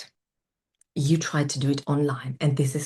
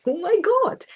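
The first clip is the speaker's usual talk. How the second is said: much higher, thrilled disbelief; excited squeal; 14 semitones higher than usual. Retold or imitated. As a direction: squeal with delight. Stunned at five sign-ups.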